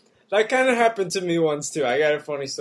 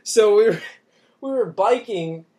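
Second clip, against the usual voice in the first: laughing; 5 semitones higher, 5 dB louder than usual. Real, not acted. Laughing while telling cotton story.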